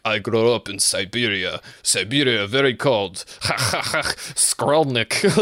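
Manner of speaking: put-on light Russian accent with a goofy laugh; a happy Siberian giant bragging about the cold; mock Russian accent. Imitating Sergey telling where he grew up, mock Russian. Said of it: "let me get my Russian".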